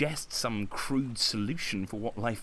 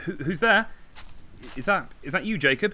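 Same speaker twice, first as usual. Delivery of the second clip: higher, louder, alarmed call; startled who's there; 7 semitones higher, 7 dB louder than usual. Acted. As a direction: call into the dark, startled and afraid. Startled by someone in the dark.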